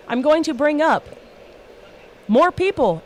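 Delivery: ordinary delivery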